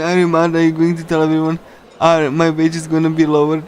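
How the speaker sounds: usual speaking voice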